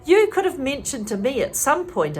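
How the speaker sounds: usual speaking voice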